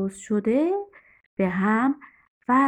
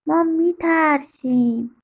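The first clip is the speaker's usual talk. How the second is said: lightly voiced scared boy, higher pitch; little boy; 7 semitones higher, 4 dB louder than usual. A narrator's reading. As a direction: plead like a small child, a touch afraid. Frightened boy voiced in a story.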